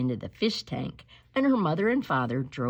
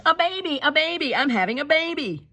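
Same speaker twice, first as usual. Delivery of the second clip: high, louder mother calling out; high yelled announcement; excited yell; 10 semitones higher, 3 dB louder than usual. Acted. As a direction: shout it out in a rush of excitement.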